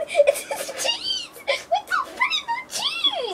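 high, giggly squeals through the words; can't stop laughing at the answer. Real, not acted. Caller cracking up at the word cheese, high giggles.

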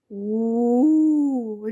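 long drawn-out monster howl; furious howl. A narrator's reading. A lake monster howls at two frightened boys, furious howl. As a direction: howl like an enraged lake beast. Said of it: "люто завила мордяка".

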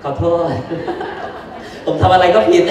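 mock apology broken by a laugh; joking self-pity. Teacher teasing trainees that he always gets blamed, joking. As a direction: play the hard-done-by one, just kidding. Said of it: "ล้อเล่นครับ".

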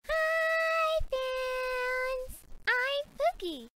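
high cheery. Acted. Pookie's cheery announcement.

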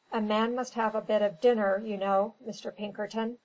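ordinary delivery, nothing marked

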